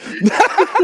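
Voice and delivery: high sudden laugh; burst of laughter. Real, not acted. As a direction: crack up at the joke. Laughs at the kebab joke.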